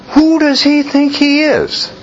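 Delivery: very high scandalised question; outraged priests. Retold or imitated. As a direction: voice their indignation.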